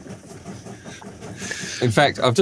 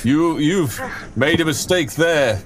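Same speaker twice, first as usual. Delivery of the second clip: slow, higher, tense bravado; defiant challenge into the dark; 7 semitones higher, slower than usual. Acted. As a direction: call a bluff into the blackness, slowly. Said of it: "he says into the dark".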